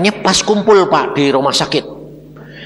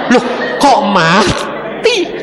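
bewildered; 7 semitones higher, slower than usual. Retold or imitated. Retelling his own bewilderment.

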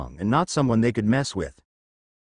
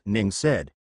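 ordinary delivery, nothing marked

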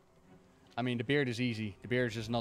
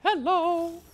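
high squeaky cartoon mouse voice; chirpy falsetto cartoon mouse greeting; Mickey Mouse; 6 dB louder, slower than usual. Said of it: "Or Mickey Mouse".

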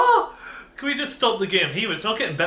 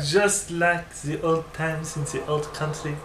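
softer put-on French accent; mock French accent; 3 semitones lower, 6 dB quieter than usual. Ambassador recalling executions back home, mock French accent. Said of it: "I'm French".

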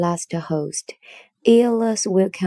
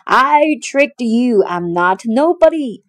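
triumphant yell; 4 semitones higher, 6 dB louder than usual. A narrator's reading. Odysseus calling back from the ship, triumphant yell. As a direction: crow over the beaten giant. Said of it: "turning back and yelling".

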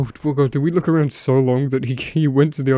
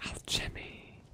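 whisper; 20 dB quieter, much slower than usual. Real, not acted. Whispers name of item found.

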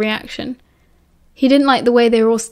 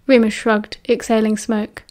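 plain reading voice

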